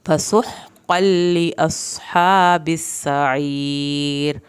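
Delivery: very slow chanted recitation; Quran recitation. Shown as a demonstration. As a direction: draw out each vowel like a reciter. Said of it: "ہا کو حلق سے پڑھیں".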